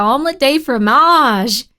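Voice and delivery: showy mock-French delivery; playful French flourish. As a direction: stretch "fromage" with hammed-up French flair. Repeats French phrase with more flourish.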